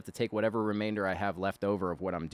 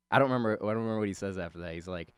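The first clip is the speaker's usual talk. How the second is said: ordinary delivery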